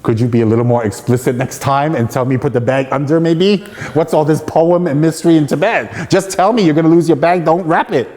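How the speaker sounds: comic outburst of pent-up irritation, rising in pitch; exasperated inner rant. Retold or imitated. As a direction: rant indignantly at the teacher in your head. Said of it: "my evil mind was thinking".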